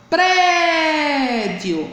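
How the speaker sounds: "Prédio" with a long, high PRÉ; teacher's PRÉÉÉ-dio stress demo; stressed syllable drawn out. Shown as a demonstration. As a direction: stretch the first syllable of "Prédio", then let it fall. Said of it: "pronunciado com força".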